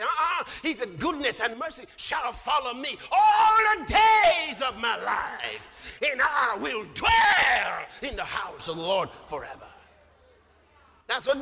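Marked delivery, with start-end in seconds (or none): 1.0-5.7 loud preacher's proclamation, swelling on "all the days"; emphatic scripture declaration. Retold or imitated. Emphatic corrected repeat of verse. 6.0-9.7 shouted, stretched "dwell", then easing down; emphatic scripture declaration. Retold or imitated. Verse proclaimed at full force.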